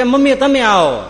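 loud, high call across the house. Retold or imitated. Son calling mother to help with the puzzle, loud high call. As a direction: shout for mom to come over.

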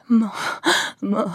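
sobbing. Acted. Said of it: "Minden szótag után levegőért kiáltott".

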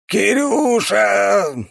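high, drawn-out dying shout. A narrator's reading. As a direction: stretch out a last cry. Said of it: "только и успел прокричать".

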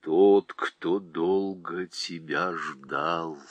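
low, slow and soft; a calm deep presence speaking gently; deep quiet voice. Acted. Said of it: "негромкий, но очень глубокий голос". (негромкий глубокий голос)

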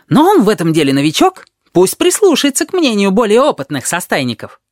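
high, loud, agitated character voice; excitable know-it-all protesting; worked-up objection. A narrator's reading. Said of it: "вскинулся Шакал".

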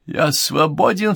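high, drawn-out cry of release; exclaiming. A narrator's reading. Wilde freed from the circle, high exclamation. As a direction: cry out, voice lifted and stretched. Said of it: "вскричал Уайльд".

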